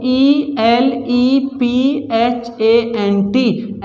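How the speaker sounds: slow letter-by-letter spelling. Shown as a demonstration. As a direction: spell elephant out slowly. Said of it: "मैं कहने लगूँ".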